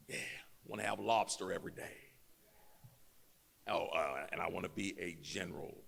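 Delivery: lightly voiced wishful recruit writing his own orders; mock dreamy recruit. Preacher mocks recruits dreaming up their own orders, low and soft. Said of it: "Guess what they would look like?".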